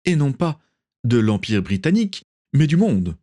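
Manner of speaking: lightly voiced governor defending himself; justifying. A narrator's reading. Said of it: "justifia le gouverneur".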